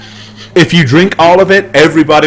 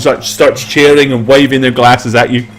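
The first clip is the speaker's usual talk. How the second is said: ordinary delivery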